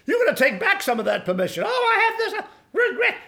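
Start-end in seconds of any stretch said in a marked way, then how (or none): 1.7-3.2 very high mocking wail; mock whining regret. Preacher mimics someone holding on to regret, mock wail. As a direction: put on a high, hammed-up voice of regret.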